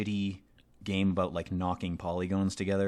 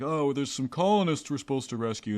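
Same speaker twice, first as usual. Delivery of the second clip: lightly voiced soldier; 7 semitones higher, 5 dB louder than usual. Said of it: "And it's like".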